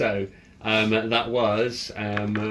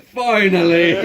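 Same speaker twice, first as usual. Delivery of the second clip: loud, high burst of relief; excited shout; 8 semitones higher, 10 dB louder, slower than usual. Retold or imitated. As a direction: yell it like it's finally happened. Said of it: "Dave's just like".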